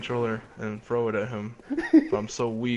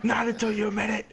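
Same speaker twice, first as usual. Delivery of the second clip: stubborn demand; 10 semitones higher, faster than usual. Acted. Rival refuses to put IV back.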